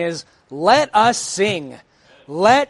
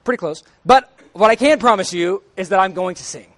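ordinary delivery

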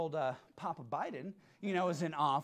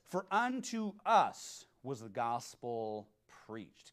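slow, lower reading of the verse; scripture reading; 7 semitones lower, much slower than usual. Acted. Preacher reading Hebrews aloud, slow and solemn. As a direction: read it slowly, solemnly.

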